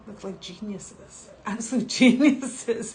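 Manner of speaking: slow, loud laughing praise; chuckling emphasis. Real, not acted. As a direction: laugh through the words.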